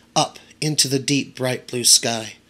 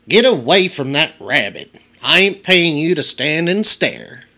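put-on scolding old woman; 3 semitones higher, 4 dB louder than usual. A narrator's reading.

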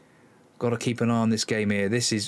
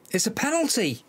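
shocked disbelief; 10 semitones higher than usual. Real, not acted. Penalty given against them.

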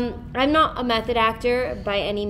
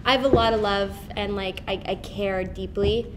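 usual speaking voice

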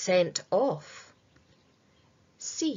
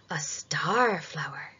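playful whisper; 4 semitones lower, 3 dB quieter than usual. Acted. Quizmaster to children, whispered last option. Quiz host building suspense.

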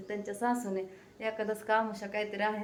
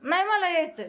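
high, louder firm no; insisting; 7 semitones higher, 5 dB louder than usual. Real, not acted. Wife insists on going shopping herself, high and firm. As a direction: stand your ground.

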